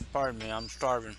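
talking through a mouthful of food; words round a bite of food; mouth full. Real, not acted. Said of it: "caught me with food in my mouth".

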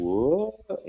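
high-pitched mock surprise; startled. Hero under surprise attack, high startled question. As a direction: voice swooping up in disbelief. Repeats the acted startled reply.

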